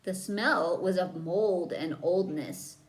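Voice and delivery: ordinary delivery, nothing marked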